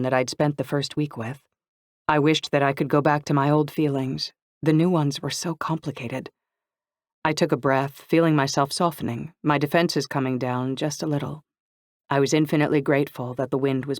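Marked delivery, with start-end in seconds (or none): none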